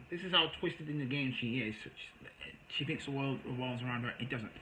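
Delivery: ordinary talk, nothing marked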